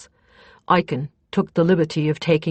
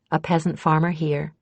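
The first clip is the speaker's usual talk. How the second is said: ordinary delivery, nothing marked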